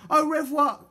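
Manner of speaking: put-on high, bright-pitched girl's courteous reply; polite. A narrator's reading. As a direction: answer the farewell politely. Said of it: "replied Polly politely".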